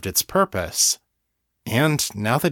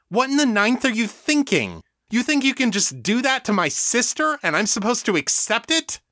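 much higher, furious and incredulous; outraged tirade; 12 semitones higher than usual. A narrator's reading. Daniel rages at the hive for hurting Stacy, furious. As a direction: keep railing at them, livid.

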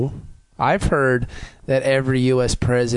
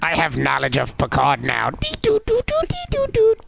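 toy robot voice. Pretend. Robot Picard speaks.

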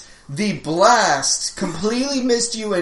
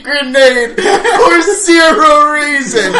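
talking through laughter; laughing; 6 semitones higher, 8 dB louder than usual. Real, not acted. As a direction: laugh while telling it.

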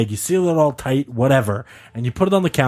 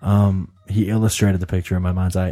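lightly lowered, calm storyteller voice; calm storytelling tone; 5 semitones lower than usual. Pretend. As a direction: tell it like a soothing bedtime story. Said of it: "calm tone I'm speaking in".

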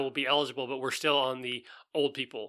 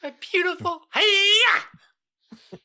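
very high swooning diva voice rising into a karate cry; Miss Piggy; 7 dB louder, much slower than usual. Retold or imitated. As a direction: coo like a vain diva, then shriek a karate chop. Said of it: "wants to hear your best Miss Piggy impersonation".

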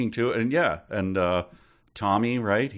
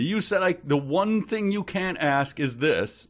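high-pitched, incredulous protest; mock indignation; 8 semitones higher than usual. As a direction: argue back as if cheated. Acting out protest at changed rules.

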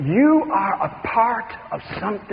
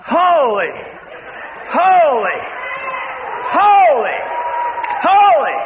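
building angelic shout; 10 semitones higher, much slower than usual. Acted. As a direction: climb from call to full cry. Creatures answering God, building.

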